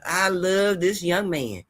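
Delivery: normal talking voice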